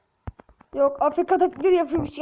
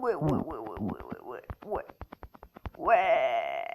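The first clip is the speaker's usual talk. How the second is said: much lower, softer chant of vay; vay creature talk; 14 semitones lower, 3 dB quieter than usual. Pretend. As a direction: growl out the vays like a game creature. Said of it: "Adamlarla vay diye konuşuyoruz".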